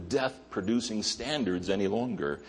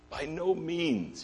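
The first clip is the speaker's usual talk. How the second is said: higher, slow and deliberate; slow emphatic quote; 8 semitones higher, 5 dB quieter, slower than usual. Retold or imitated. Paul answering the question, slow and emphatic. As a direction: drag out each word for weight. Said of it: "He says with an emphatic".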